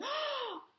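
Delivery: high gasp of wonder; excited gasp. Acted. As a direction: gasp with delight. Excited about fairies.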